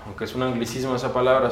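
normal talking voice

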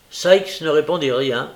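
usual speaking voice

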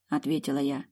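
plain reading voice

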